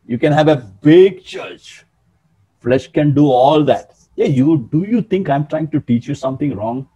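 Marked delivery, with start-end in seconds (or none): none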